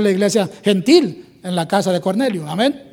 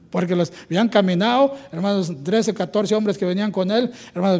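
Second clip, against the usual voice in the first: ordinary talk, nothing marked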